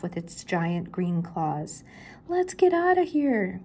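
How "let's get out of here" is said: much higher, slow panicked cry. Acted. Shaggy fleeing the monster, high panicked cry. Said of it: "cried Shaggy".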